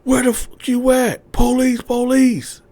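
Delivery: very high, loud shouted commands; raid-style shouting; police yelling. Retold or imitated. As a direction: officers searching the house, loud. Swat team inside house.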